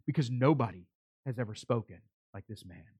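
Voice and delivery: low hush, fading very soft; awed. Retold or imitated. Officers explaining why they didn't arrest him, in hushed awe. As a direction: sink into quiet, reverent wonder. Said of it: "There is an awe that came over these officers".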